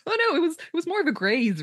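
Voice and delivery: high-pitched mock fluster; nervous backtracking. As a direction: imitate someone hastily backing down. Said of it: "nearly falls over himself trying to back away".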